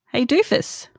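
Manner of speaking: high-pitched, mild mock call-out; mock scream. The body scolds the person who ignored it, mildly mock-screaming. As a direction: call out as the fed-up body, without shouting. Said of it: "it starts screaming".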